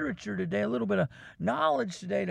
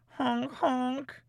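clown horn honk; 5 semitones higher than usual. Acted. Imitating clown horns.

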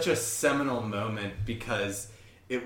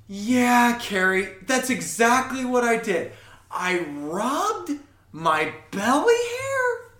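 much higher, louder, slowed, guileless voice; innocent tone of voice; 11 semitones higher, 8 dB louder, slower than usual. Retold or imitated. Doug answering Carrie's scolding, high innocent drawl. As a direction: confess it sweetly as if nothing were wrong. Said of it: "say it in that tone of voice".

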